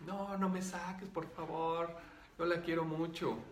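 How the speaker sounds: high, pleading mock child voice; frightened little girl. Spirit begs to stay with student, imitated frightened little-girl voice. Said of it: "lo que tenía era miedo".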